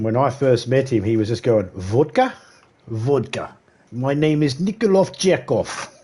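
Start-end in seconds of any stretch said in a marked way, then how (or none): 1.8-5.9 hammed-up Russian accent, slow and heavy; bad Russian accent. Streamer mocks teammate Major with a bad Russian accent. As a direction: play a cartoon Russian introducing himself. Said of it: "that very, very bad accent".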